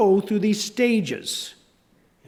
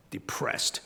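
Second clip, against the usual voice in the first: whispered; 12 dB quieter than usual. A narrator's reading. Describing Calvin's mood.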